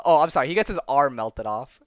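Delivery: usual speaking voice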